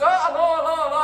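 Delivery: loud, high phone hello; exaggerated repeated calling out; pretending not to hear. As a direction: call into a supposedly dead line.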